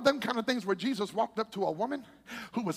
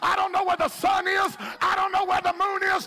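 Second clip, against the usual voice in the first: loud, high, shouted preaching over music; shouted sermon climax; full-force preaching; 9 semitones higher, 6 dB louder than usual. Acted. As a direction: preach it hard and climb.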